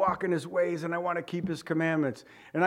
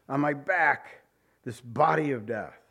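ordinary talk

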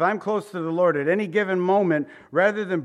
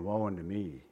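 humbled confession; 7 semitones lower, 8 dB quieter than usual. Retold or imitated. Someone who has seen the Lord, soft humbled confession. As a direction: lower and soft, owning the woe. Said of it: "Because you'd be saying".

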